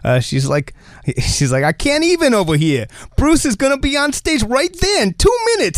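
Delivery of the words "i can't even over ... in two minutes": put-on high, excited Jersey accent. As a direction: gush like a starstruck Jersey fan. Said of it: "I can do a Jersey accent".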